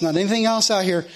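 ordinary delivery

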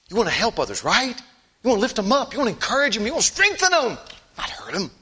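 rising, high, loud exhortation; fervent climbing exhortation; preaching at full force. Acted. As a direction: build it up into a cry.

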